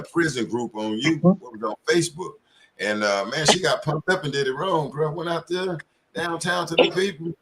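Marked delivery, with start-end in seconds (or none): none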